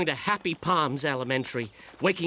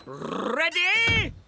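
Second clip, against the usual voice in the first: high, swelling, drawn-out call; gleeful far-pitched holler; eager announcement; 10 semitones higher than usual. Acted. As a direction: sing out readiness with a rising swoop. George announces he is ready.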